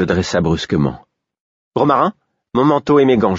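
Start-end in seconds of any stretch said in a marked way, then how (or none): 1.8-3.4 excited call. A narrator's reading. Queen calling servant, overjoyed.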